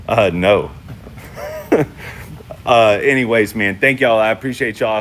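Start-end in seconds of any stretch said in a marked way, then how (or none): 0.8-2.6 wheezy laugh. Real, not acted. Laughing at scam chat message.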